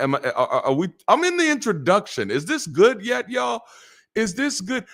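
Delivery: usual speaking voice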